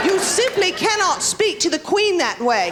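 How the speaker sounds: high, slow mock-British outrage; hammed-up British reprimand, pitched high; scandalised British scold. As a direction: imitate an affronted Londoner with a British accent. Imitates scolding Londoners after her insult.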